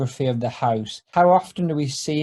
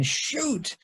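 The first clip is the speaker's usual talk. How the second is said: high, soft Flanders curse; high soft Ned grumble; Ned's mild curse; 11 semitones higher, 7 dB quieter than usual. Acted. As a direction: squeak it out under your breath. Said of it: "says, uh, Ned".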